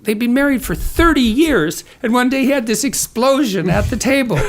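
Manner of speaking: chuckling through the story, voice lifted; laughing while telling. Real, not acted. Telling the host a funny family story, laughing. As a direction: let the laugh leak into the words.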